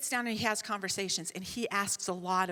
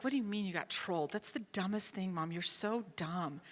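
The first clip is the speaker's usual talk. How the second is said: lowered volume, withering and slowing at the end; deadpan scolding son; 3 semitones lower, 7 dB quieter than usual. Retold or imitated. Son scolding his mom, understated exasperation. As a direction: let the last words drag in exasperation.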